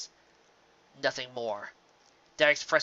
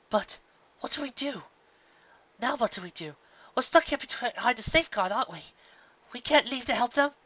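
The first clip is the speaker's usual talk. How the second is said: anxious; 10 semitones higher than usual. Acted. Luna anxious, younger sister.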